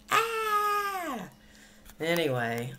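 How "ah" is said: high piercing scream, loud; real scream. Real, not acted. Upset over a bad haircut, a real scream. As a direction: let out a sudden shrill-pitched scream. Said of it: "I may scream".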